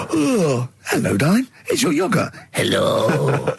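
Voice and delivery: high, sweet gasping yogurt greeting; talking yogurt voice. Retold or imitated. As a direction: chirp it like a cheery talking yogurt. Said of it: "he does all as a little scenario".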